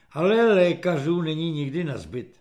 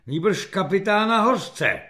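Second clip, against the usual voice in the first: plain reading voice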